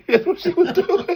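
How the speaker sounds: loud, high laughing through words; words pushed out through big laughter; laughing hard. Real, not acted. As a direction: crack up while saying it. Laughing while retelling.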